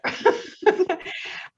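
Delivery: breathy high nervous laugh fighting back tears; emotional laugh. Real, not acted. Mentor laughing through emotion at the team's pitch. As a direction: laugh it off to keep from crying. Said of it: "I'm gonna try not to cry here".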